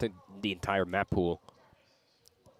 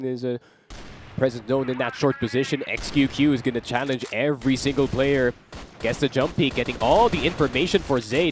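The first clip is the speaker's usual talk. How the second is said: loud, fast-rising esports call; live play-by-play; 5 semitones higher, 7 dB louder than usual. Acted. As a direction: call the action at full hype.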